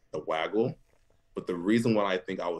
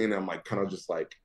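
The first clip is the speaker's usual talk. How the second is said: ordinary delivery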